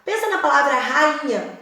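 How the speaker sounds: normal talking voice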